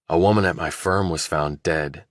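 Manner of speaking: ordinary delivery, nothing marked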